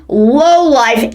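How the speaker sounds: usual speaking voice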